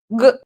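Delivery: "G" as a short hard guh; voiced back-of-tongue burst for "G"; sound of G. Shown as a demonstration. Teacher sounding out the letter, short guh. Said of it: "tenho o C e o G".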